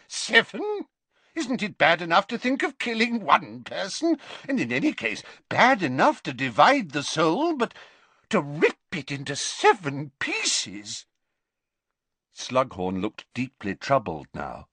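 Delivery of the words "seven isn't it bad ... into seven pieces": high-pitched, appalled professor voice; horrified professor. Acted. Professor appalled at ripping soul into seven, horrified. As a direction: shoot up high in disbelief, keep protesting.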